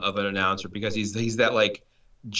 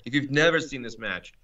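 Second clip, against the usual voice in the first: ordinary talk, nothing marked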